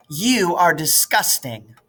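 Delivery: slow, high, priggish disgust; prim girl repelled by the slurp; prissy disgust. Acted. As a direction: drag out the disdain. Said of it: "all priss-faced".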